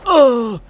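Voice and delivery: loud fed-up ugh; sharp exasperated ugh; frustrated groan. Real, not acted. Frustrated with the car handling.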